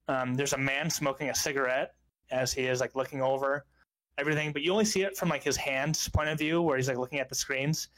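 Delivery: ordinary delivery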